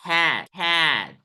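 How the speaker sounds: हैट spoken very slowly, vowel stretched; word demo. Shown as a demonstration. Teacher modelling हैट very slowly for copying. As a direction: drag out हैट syllable by syllable. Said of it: "दिख रहा है ना?".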